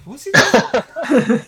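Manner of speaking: high, loud, slowed cry of disbelief; aghast disbelief. Retold or imitated. Recalling his horror at Mark's remark, loud and high. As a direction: stretch it out in shocked horror. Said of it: "And I'm like".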